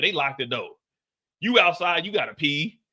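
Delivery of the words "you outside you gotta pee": usual speaking voice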